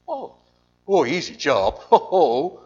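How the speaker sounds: scoffing "easy job" with a loud laugh; sarcastic laugh. Real, not acted. Preacher mocking Jonah's easy job, laughing. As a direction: laugh it off as a joke.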